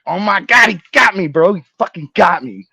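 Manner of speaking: loud, high wail of disbelief; acted, amazed shouting; astonished outcry. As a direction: cry out at being completely had. Reenacts realizing dad tricked him.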